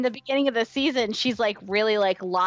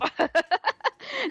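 laughing; 10 semitones higher than usual. Real, not acted. Laughing after the scream.

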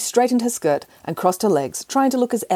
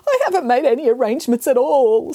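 sobbing; 7 semitones higher, 4 dB louder than usual. Acted. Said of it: "sobbed the cat".

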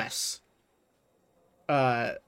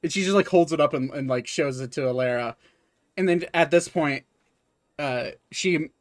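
ordinary delivery

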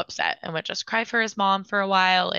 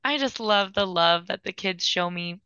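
ordinary talk

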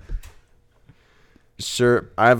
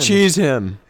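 shouting; 4 semitones higher, 5 dB louder than usual. Acted. Improv battle cry.

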